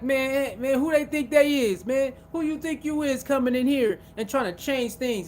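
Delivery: high-pitched indignant mimicry; mock resentment. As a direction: imitate put-out regulars challenging a newcomer. Voicing resentful people about a newcomer.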